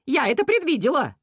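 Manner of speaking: high, emphatic bird voice; cuckoo declaring. A narrator's reading. Cuckoo telling the animals she foresaw it, high and emphatic.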